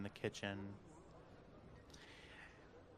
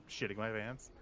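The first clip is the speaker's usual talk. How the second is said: ordinary delivery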